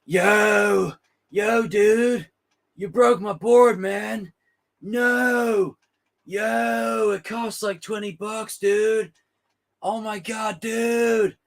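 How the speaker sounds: high laid-back surfer dude. Retold or imitated. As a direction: whine like a chill surfer whose board broke. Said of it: "I'm gonna say it like this".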